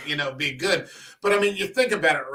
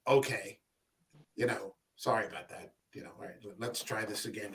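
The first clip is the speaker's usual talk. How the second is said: quiet; 8 semitones lower, 11 dB quieter than usual. Retold or imitated. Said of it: "And he's like".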